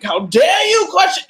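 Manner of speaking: huffy royal offence at being questioned; perfection voice. Retold or imitated. Imitating her ego's voice, a queen scolding, mock indignant. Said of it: "my perfection voice".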